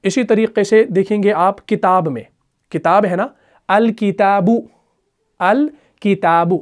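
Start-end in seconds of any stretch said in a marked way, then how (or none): 3.7-6.6 "अल किताबु" recited slowly with the al fully sounded; "अल किताबु" slow and distinct; Arabic recitation. Shown as a demonstration. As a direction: pronounce "अल किताबु" slowly, every letter clear. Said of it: "अल किताबु में देखिए".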